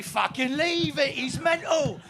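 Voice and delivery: lightly voiced alarmed London thug, drawn out; mock Cockney warning shout to mates; a hint of panicked yob; retreating hooligan. Acts out fleeing football hooligans.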